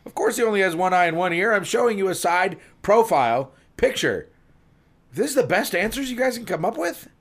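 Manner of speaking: acted angry outburst, pitch climbing high; angry policeman. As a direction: scold them in rising disbelief. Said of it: "The policeman angrily responds".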